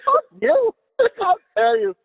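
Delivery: high-pitched, squealed mock offence; mock indignation. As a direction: act wildly affronted, voice shooting up. Acts offended retort to wife's mockery.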